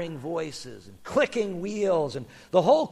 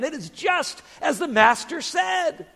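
high-pitched excited exclamation; shouted; 9 semitones higher than usual. Acted. Second man confirms the master's word, excited shout. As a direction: call it out in amazement. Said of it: "shouted the other man".

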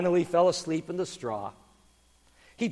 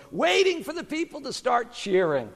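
usual speaking voice

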